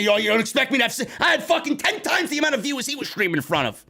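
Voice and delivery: high, fast, shouting the point; heated rant. Real, not acted. Streamer ranting about a rival reporter, fast and high. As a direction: hammer the numbers home in a fury.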